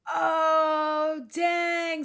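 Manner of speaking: loud, high "oh, dang!"; shocked cry. Real, not acted. Reacting to the autograph burn, a shocked high cry. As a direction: cry out like the joke landed hard.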